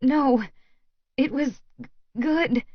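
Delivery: high, halting tearful voice; through tears. A narrator's reading. Woman reassuring lover it was good, tearful and halting. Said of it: "I say through my tears".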